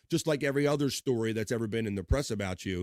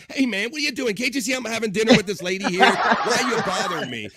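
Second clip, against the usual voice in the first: much higher, louder, faster hammed-up indignant yell; fake-furious at the interruption, laughing through it; mock outrage; 10 semitones higher, 9 dB louder than usual. [name] mock-yelling at [name] for bothering his dinner. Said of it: "He goes".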